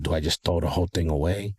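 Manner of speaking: normal talking voice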